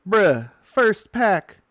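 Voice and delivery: loud, high and slow, drawn out in disbelief; stunned shout. Real, not acted. First pack for the stream, stunned loud slow shout. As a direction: stretch it out, floored by the pull.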